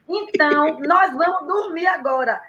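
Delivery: lightly mock-firm talk to a baby; babysitter reasoning with baby. Babysitter telling the baby it is bedtime, imitated firmness. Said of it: "ela falava assim pra menina".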